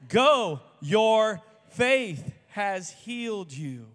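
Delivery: loud, very slow, with "Go," high and punched; loud proclamation. Acted. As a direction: proclaim the healing slowly and loud. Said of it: "And Jesus says".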